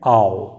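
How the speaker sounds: आओ slowly drawn out as "aa-o"; slow, stretched आओ; diphthong demo. Shown as a demonstration. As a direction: model आओ as a long "ow" glide. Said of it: "इसको बोलिए आओ".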